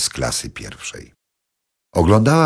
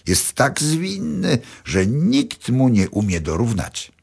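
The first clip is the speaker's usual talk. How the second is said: much higher, slow proud boasting; boastful mother; 9 semitones higher, slower than usual. A narrator's reading. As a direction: show off your son to the others. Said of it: "mówiła pierwsza". (pierwsza kobieta chwali syna, wolno i dużo wyżej)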